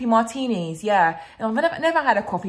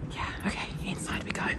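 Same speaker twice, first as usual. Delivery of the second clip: hushed whisper going inside; quiet whisper at the door; 11 semitones lower, 11 dB quieter, faster than usual. Real, not acted. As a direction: whisper so nobody hears. Entering fancy restaurant whispering.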